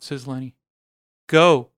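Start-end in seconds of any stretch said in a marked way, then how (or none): 1.3-1.7 urgent go. Acted. Urging Lenny to leave now.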